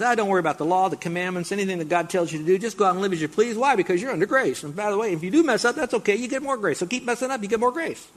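mock tempting Satan. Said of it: "he then says".

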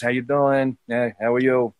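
lightly done New York tough-guy actor; Robert De Niro. Acted. Quoting the common impression, a light De Niro. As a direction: toss it off low-key in a movie tough-guy voice. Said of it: "you know, the De Niro's".

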